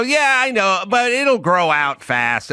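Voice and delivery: smooth it over kindly; placating reassurance. Retold or imitated. Consoling a friend about a bad haircut, placating. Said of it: "And then I would go".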